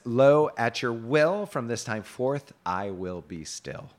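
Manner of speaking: slow, stately quoting; declaimed knight's reply. A narrator's reading. Reads Sir Kay's reply.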